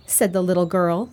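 usual speaking voice

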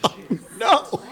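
high, squealing oh my God; horrified disbelief. Retold or imitated. Retelling the vomit bucket to his friend, high disgusted squeal. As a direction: recoil in disgust, voice way up.

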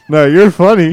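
loud insistent praise; loud gushing compliment. Pretend. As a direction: push the praise out. Mock compliment.

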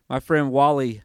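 ordinary talk, nothing marked

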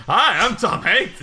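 hammed-up Tom Hanks greeting; a movie star introducing himself, slow and loud; Tom Hanks impression. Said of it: "but he goes".

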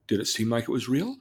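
ordinary talk, nothing marked

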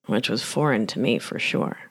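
ordinary delivery

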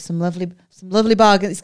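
a Liverpool stallholder, thick scouse; imitating a scouse stallholder's cry; broad scouse. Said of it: "He's dead, dead scouse".

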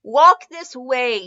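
drawn-out instruction from a stooped servant; hunchback henchman. A narrator's reading. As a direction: beckon slowly as the hunched assistant. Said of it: "crouched over and leaning on a stick".

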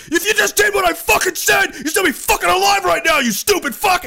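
high furious mock shout at a dead soldier; yelling NCO. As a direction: scream it like a drill sergeant who lost a man. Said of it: "he's like".

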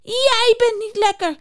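high, angry beaver voice; angry call. Acted. The beaver angrily calls back at Juniper, high-pitched. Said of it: "roept de bever nijdig".

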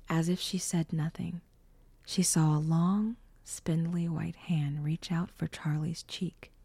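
usual speaking voice

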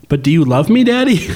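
lightly voiced toddler asking, rising high; a hint of a small child begging; little girl pleading. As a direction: daughter asking her dad for reassurance. Voicing toddler daughter.

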